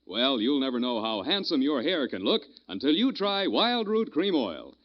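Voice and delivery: usual speaking voice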